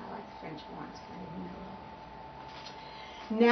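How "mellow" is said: usual speaking voice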